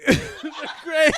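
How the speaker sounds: high, loud laughing repeat. Real, not acted. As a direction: crack up while repeating it.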